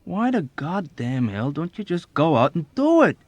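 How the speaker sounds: loud, high, irritated; exasperated protest. A narrator's reading. Airman to his hesitant friend, exasperated protest. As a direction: protest hotly, voice climbing. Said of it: "he protested".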